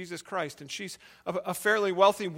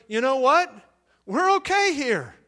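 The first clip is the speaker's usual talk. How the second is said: much higher, louder joyful cry; joyful exclaim; 6 semitones higher, 9 dB louder than usual. Retold or imitated. Paul in chains tells Silas, higher and louder. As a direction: lift it up with glad confidence.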